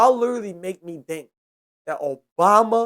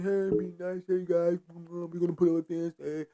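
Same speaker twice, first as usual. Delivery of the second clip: mock soft Obama; 8 dB quieter than usual. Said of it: "Obama was just saying".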